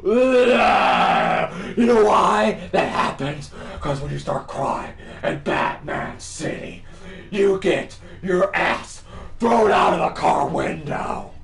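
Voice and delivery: loud, emphatic vigilante rant; triumphant lecture. Acted. Batman warning criminals, loud emphatic boast. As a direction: drive each phrase home in a growly shout.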